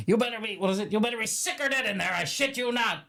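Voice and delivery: high-pitched, hammed-up threat; put-on high character voice; film character impression. Quoting Hay's line from the film.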